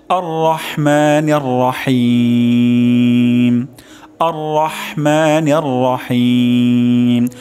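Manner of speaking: slow, melodic chanted recitation; Quran recitation. Shown as a demonstration. Teacher reciting the whole verse again for students, melodic chant.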